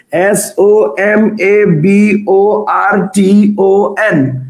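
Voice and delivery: letters dropped one at a time, very slow; slow letter spelling. Shown as a demonstration. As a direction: spell it out slowly for the class. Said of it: "teacher spells word letter by letter".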